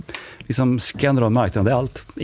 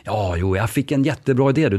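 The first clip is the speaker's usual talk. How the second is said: ordinary talk, nothing marked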